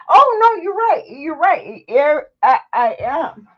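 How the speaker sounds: high, loud and crying; tearful. Real, not acted. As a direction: fight back tears. Answering viewer, crying.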